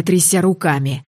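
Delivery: ordinary delivery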